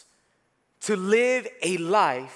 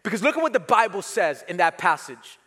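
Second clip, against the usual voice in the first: ordinary delivery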